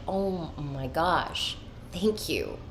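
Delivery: soft, drawn-out awed thanks; slow and hushed, soaking in relief; awed gratitude. Retold or imitated. Woman retelling her grateful moment of realization, softly awed.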